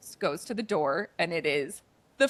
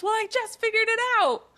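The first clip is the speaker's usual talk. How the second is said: high voice; 5 dB louder than usual. Retold or imitated. Pepa's reply in a high voice.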